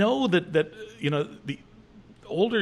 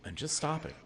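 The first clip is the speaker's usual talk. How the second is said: calm self-report; 11 dB quieter than usual. Shown as a demonstration. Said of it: "even if you just say".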